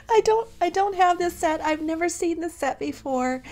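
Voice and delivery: high, loud, thrilled through tears; overjoyed and teary; tearful excitement. Real, not acted. Tearful excitement over gift set.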